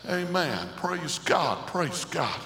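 normal talking voice

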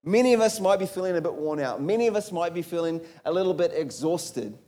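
normal talking voice

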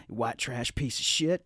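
low, quiet, slow quote; understated killer's one-liner, slowly; retold one-liner. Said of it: "as he's choking out Joe Don Baker, he goes".

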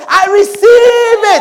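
high, slow, loud "I receive it"; eager shout of faith. Acted. Congregant shouting back to the preacher, eager and loud. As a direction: claim the blessing joyfully.

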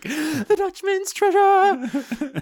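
high, slow, laughing repeat; drawn-out giggly exclamation; laughing echo. Real, not acted. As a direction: savour it through laughter. Echoing the treasure line laughing.

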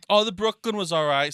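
unwitting touch of Irish brogue; Irish accent. Real, not acted. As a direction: slip back into an Irish accent. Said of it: "you just did that Irish right now".

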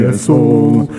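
ordinary talk, nothing marked

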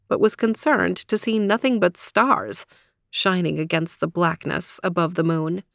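ordinary delivery

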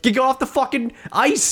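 high mock yell at a teammate; high-pitched mock teammate yelling; mock angry teammate. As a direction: bark it like an annoyed teammate.

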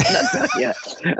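high, slow, through laughter; laughing retelling. Retold or imitated. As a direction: repeat the quoted protest while laughing. Repeats caller's line while laughing.

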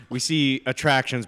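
ordinary delivery

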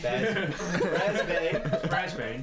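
slow, laughing repeat of "bear's bane". Real, not acted. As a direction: crack up at the silly word. Laughs repeating a funny word.

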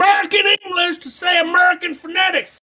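high, loud mock-outraged protest; indignant shout. Real, not acted. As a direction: yell it high, insisting on your own language. Protests being asked for Russian accent.

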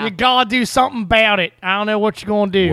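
broad mock Baltimore accent. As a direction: go full Baltimore local complaining. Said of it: "an Australian doing a Baltimore accent".

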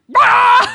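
loud, high comic roar; mock enraged roar. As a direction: burst out with a hammed-up angry yell. Acting out her furious reaction to compliment.

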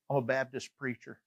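soft, low-key retold reveal; understated admission. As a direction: slip the confession out quietly. Understated reveal, later redone bigger.

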